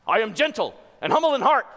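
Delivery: loud, high, rushed declaration; speedy and forceful. Acted. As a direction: fire it out fast and hard. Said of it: "speedily and forcefully".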